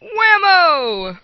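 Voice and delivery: high, loud exclamation; excited shout. Real, not acted. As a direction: shout it with delight. Sudden reveal of the shrine.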